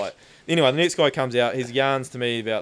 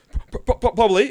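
a nervous cop stammering on "P-, p-, p-, probably", higher and louder; stuttering cop; 10 semitones higher, 7 dB louder, faster than usual. Retold or imitated.

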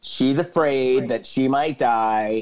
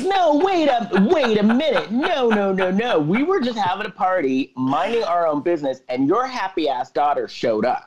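indignant protest; 7 semitones higher than usual. Acted. Partygoer answering the yelling mom, indignant protest. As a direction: protest as a wrongly blamed partygoer.

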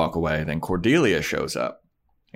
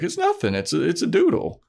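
higher, quick denial in a high voice; nervous Willow; 9 semitones higher than usual. Retold or imitated. Willow denies the symbol to Buffy, higher and nervous. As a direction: brush it off high and fast.

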